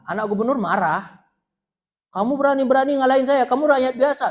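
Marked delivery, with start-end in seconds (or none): 2.1-4.2 high, loud furious scolding; a spoiled official's son shouting at the commoner who beat him; angry rebuke. Retold or imitated. Angry governor's son confronts commoner.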